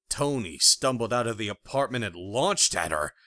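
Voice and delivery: plain reading voice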